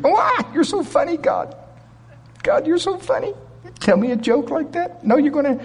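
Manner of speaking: very high-pitched, hammed-up disbelief; laughing Sarah. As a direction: play someone tickled by an absurd promise. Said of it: "what did he say to Sarah when she laughed?".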